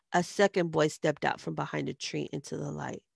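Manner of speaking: plain reading voice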